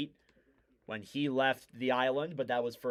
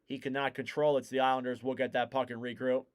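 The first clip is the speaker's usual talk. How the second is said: brisk hockey commentary, rising on chances; live play-by-play; 4 dB louder than usual. Real, not acted. Commentator calls the Islanders game for listeners, live play-by-play. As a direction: follow the puck, lifting the call as danger builds.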